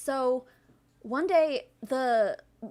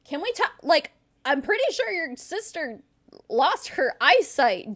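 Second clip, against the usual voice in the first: high, loud and exasperated, half laughing; playful sibling exasperation; exasperated scolding; 7 semitones higher, 6 dB louder than usual. Real, not acted. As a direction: nag her sister with mock outrage. S0 mock-scolds sister on camera.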